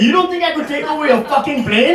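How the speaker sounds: ordinary delivery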